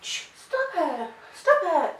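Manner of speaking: high, soft, slow, laughing "stop it"; weak giggly telling-off; half-hearted scolding. Shown as a demonstration. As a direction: scold without meaning it. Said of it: "if you go".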